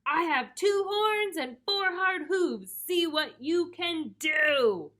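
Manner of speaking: slow, high, forceful boast; defiant storybook goat bellow; loud big goat voice. A narrator's reading. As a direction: challenge the troll in a booming voice. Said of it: "as loud as the troll's".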